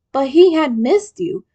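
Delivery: ordinary delivery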